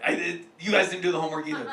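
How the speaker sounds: ordinary delivery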